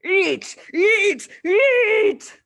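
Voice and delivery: rising loud repeated shouts; hammering yell. A narrator's reading. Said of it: "the Trunchbull was yelling".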